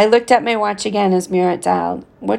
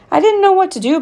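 high, louder pleading; 12 semitones higher, 4 dB louder than usual. A narrator's reading. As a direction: plead with him. Pleading son to angry father.

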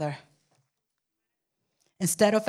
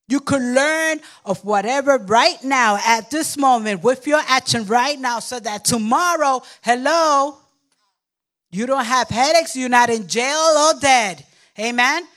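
pushing it out loud like a sermon climax; loud revival preaching; fervent preaching; 4 semitones higher, 7 dB louder than usual. Real, not acted. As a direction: preach it hard to the room. Preaching at full force.

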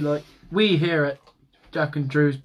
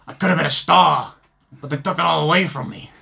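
a light mock New Yorker, louder, lamenting; New York accent; 6 dB louder than usual. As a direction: play a washed-up New York fighter whining. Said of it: "Why do I sound like a New Yorker?".

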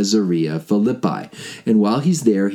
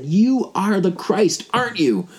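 slow, higher-pitched awed declaration; awed confession; 10 semitones higher, slower than usual. Acted. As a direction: proclaim it slowly in wonder. Peter's confession, slow and high.

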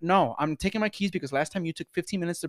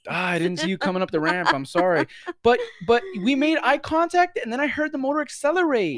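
mock innocent excuse; 11 semitones higher, 8 dB louder than usual. Worker hamming up a fake apology to the hit customer. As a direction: play the innocent hammed-up.

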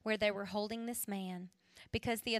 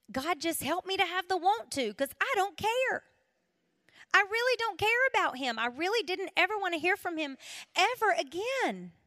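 raised, earnest prayer voice; pleading prayer; 6 semitones higher, 4 dB louder than usual. Retold or imitated. Praying at her kitchen table to forgive the killer, earnest plea. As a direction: pray it out, begging for willingness.

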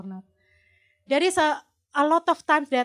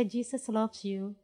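gentle reassurance; 2 semitones lower, 10 dB quieter than usual. Retold or imitated. Retelling words to a tough grandma, gently and softly. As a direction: say it very softly, as if to soothe.

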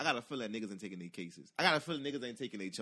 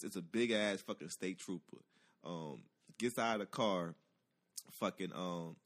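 ordinary talk

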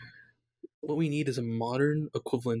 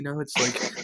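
ordinary delivery

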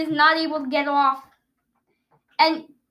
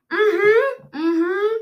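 muffled through tape; 5 semitones higher, 5 dB louder than usual. Real, not acted. Mouth taped shut, humming.

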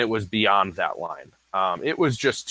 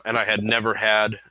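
ordinary talk

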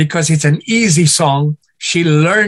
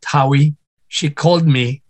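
ordinary talk

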